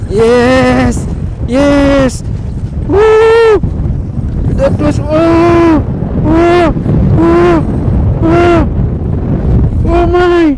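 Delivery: loud, high whoops of triumph; real cheers as the fish fights; excited cheering. Real, not acted. Angler landing a fish, whooping loud and high.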